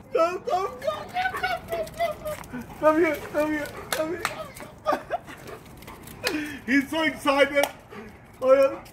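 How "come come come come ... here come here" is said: very high squeaky come come; squeaky high coaxing croon. Real, not acted. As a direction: coo at the dog in a tiny voice. Calling dog in baby voice.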